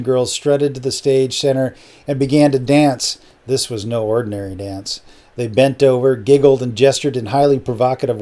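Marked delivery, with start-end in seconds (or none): none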